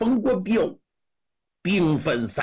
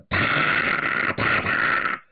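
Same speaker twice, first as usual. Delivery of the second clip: voiceless clipped "da" gunshots; imitated gunfire. A narrator's reading. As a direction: mimic distant rifle fire with the mouth. Gunfire in the story. (模仿远处枪声)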